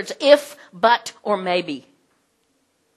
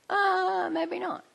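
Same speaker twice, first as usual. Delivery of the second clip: high, sing-out "uh, maybe not"; high-voiced playful second thought; mock doubt; 12 semitones higher, 4 dB quieter, slower than usual. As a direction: pitch it way up, softly correcting.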